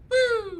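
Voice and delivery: short, high-pitched woo; high cheering whoop. Real, not acted. Cheering a shop shout-out, high whoop. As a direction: let out a quick celebratory whoop.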